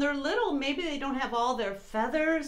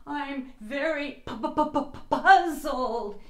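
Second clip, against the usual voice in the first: teeth-chattering stammer; shivering stutter; 3 semitones higher, 3 dB louder than usual. Acted. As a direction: stammer from the cold. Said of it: "shivering through the thick snow".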